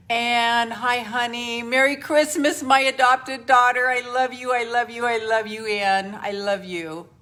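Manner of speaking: loud, high, gushing hello to a loved one; excited greeting. Real, not acted. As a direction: beam it out to her adopted daughter. Greets adopted daughter in chat.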